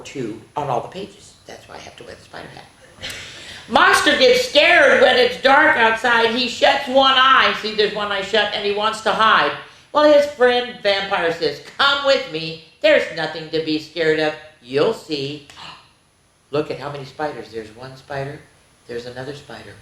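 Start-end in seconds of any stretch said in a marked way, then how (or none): 3.7-15.5 lively, higher storytime voice; reading to children. Acted. Reading picture book to children. 16.5-19.7 hushed. Real, not acted. Pointing out hidden spiders to children.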